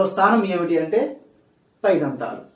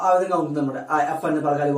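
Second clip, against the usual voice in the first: usual speaking voice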